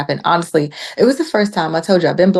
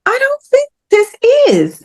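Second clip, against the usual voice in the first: high-pitched retold skepticism; disbelieving; 4 dB louder than usual. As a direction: squeal your doubt, pitch way up.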